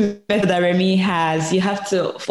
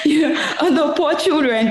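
high laugh breaking into words; 9 semitones higher than usual. Real, not acted. Host laughs at own remark.

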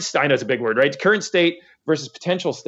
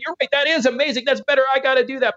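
eager, higher imitated customer voice; 11 semitones higher, faster than usual. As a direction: play the dazzled customer, pitched up high. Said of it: "your customer will see all this stuff and say".

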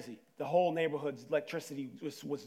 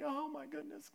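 soft, high, scared little gasp of words; a hushed, high oh my goodness; 9 semitones higher, 10 dB quieter than usual. Retold or imitated. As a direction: breathe it out like a frightened kid in the dark. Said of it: "and I'm just scared".

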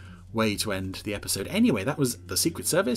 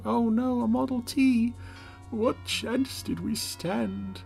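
lamenting pilot; 10 semitones higher, much slower than usual. Retold or imitated. Quoting the doomed pilot's lament.

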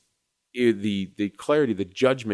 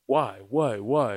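higher, louder repeated demands; retold yelling; 3 semitones higher, 4 dB louder, slower than usual. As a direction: imitate an angry driver demanding answers. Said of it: "he starts yelling".

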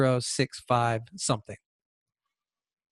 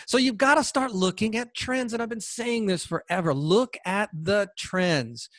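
ordinary delivery

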